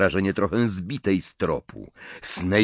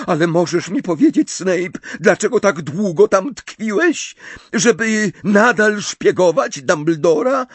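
much higher, louder, fast accusation; accusing Bellatrix; 12 semitones higher, 7 dB louder than usual. Acted. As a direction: hammer him with demands.

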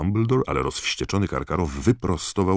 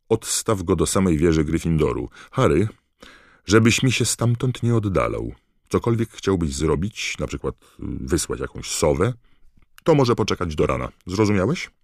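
louder, higher stern warning; a hint of the stern headmaster; 4 semitones higher, 5 dB louder than usual. Dumbledore warning Harry to stay in the tower, stern.

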